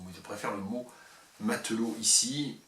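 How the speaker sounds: usual speaking voice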